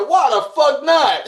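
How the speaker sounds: loud, high, slow why the fuck not; high outraged yell. Retold or imitated. To the boss who scolded him, a high outraged yell.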